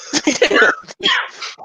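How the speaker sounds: sudden high burst of laughter; laughing out at the joke. Real, not acted. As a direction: crack up high. Laughing at leg day.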